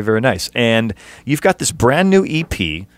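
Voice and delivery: usual speaking voice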